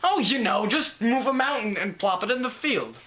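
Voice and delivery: sarcastic quip. Acted. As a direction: toss off an absurd plan as if it were easy.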